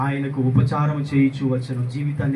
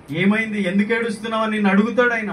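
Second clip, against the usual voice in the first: higher, insistent preacher's question; raised questioning; 6 semitones higher, 3 dB louder than usual. Acted. Preacher voicing the Lord asking why you weep, raised questioning. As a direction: ask it with voice lifted and urgent.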